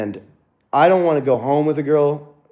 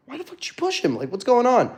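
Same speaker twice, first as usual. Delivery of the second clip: retold angry yell; 12 semitones higher than usual. Confronting woman who pushed his friend.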